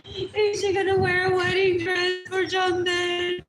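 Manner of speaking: mocking slow wail. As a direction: whine it like gossiping people. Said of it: "crying to people".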